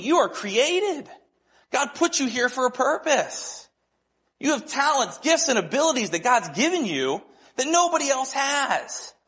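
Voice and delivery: high-pitched, fervent insistence; retold impassioned affirmation. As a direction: urge the friend to believe his worth. Retold impassioned affirmation to his friend.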